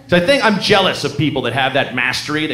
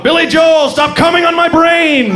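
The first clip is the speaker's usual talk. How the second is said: high, loud exasperated shout; yelling out; 12 semitones higher, 4 dB louder than usual. Retold or imitated. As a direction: yell it out like you're losing your mind. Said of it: "And I yell out".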